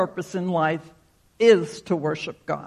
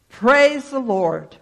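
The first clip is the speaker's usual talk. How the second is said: loud, slow shout of praise; joyful shout; 5 semitones higher, 6 dB louder, slower than usual. Acted. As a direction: cry it out in delight. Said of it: "And she shouted".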